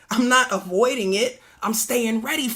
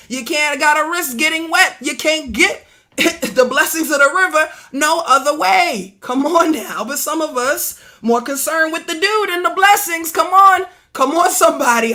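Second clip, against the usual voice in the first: rising, louder preaching call; 5 semitones higher, 8 dB louder than usual. Acted. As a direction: build the congregation up, voice climbing.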